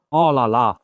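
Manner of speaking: usual speaking voice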